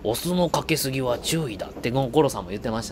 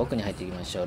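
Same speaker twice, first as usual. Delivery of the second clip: quiet aside; 5 semitones lower, 7 dB quieter, faster than usual. Shown as a demonstration. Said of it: "どんどんどんどん奥に入っていきましょう。".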